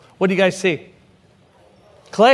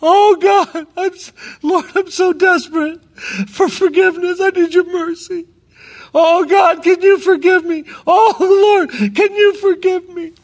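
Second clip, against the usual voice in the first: wailing prayer; 10 semitones higher than usual. Retold or imitated. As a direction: beg for forgiveness at the top of your voice, much higher. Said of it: "And I was wailing. I was crying".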